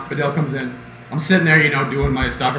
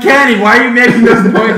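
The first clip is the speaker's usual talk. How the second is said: loud, raised mock question; mock teasing; 8 semitones higher, 9 dB louder than usual. Friends teasing Kenny in class, loud mock question. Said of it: "we're like".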